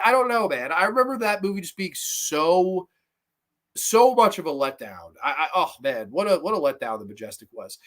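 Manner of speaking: normal talking voice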